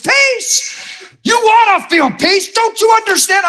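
very high, strained incredulous yell; high-pitched outburst, overwrought disbelief; retold yelling. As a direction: re-enact freaking out at her, pitch way up. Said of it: "I started yelling at her".

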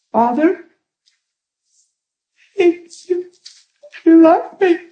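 high, halting, choked-up prayer; tearful imitation. Woman repeating a prayer she longs to believe, imitated tearfully. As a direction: imitate her struggling to get the words out. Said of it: "She goes like this".

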